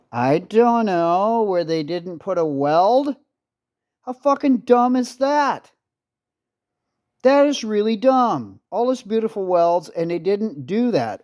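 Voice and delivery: high-pitched exasperated disbelief; real indignant, incredulous irritation; irritated. Real, not acted. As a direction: vent at a stupid design flaw. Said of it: "I'm just irritated by this".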